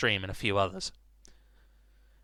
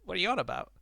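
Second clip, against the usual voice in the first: scoffing voice; 8 semitones higher, much faster than usual. Retold or imitated.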